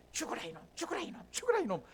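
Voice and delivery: mock curse, starting hushed and jumping high; imitated shaman curse. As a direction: play someone jabbing a doll while cursing it. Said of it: "무속신앙에 보면 인형 같은 거 만들어가지고 막 바늘로 콕콕 찌르면서". (저주를 퍼붓는 흉내)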